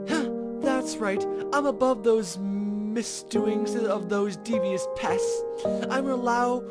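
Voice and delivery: mock-grand villain monologue with a snicker; plotting villain. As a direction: gloat over your coming revenge. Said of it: "plotting like a villain".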